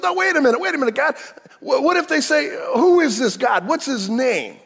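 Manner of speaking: high, loud objecting Moses; panicked Moses. Acted. Preacher acting Moses protesting to God, high and alarmed.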